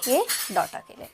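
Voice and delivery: usual speaking voice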